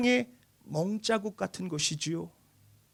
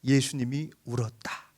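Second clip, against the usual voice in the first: slow; 6 semitones lower, 6 dB quieter, slower than usual. Shown as a demonstration. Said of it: "이 구절이 가장 짧아요".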